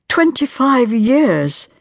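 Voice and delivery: drawn-out tormented cry. Acted. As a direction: let the years torment you. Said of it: "said Marian in a tormented voice".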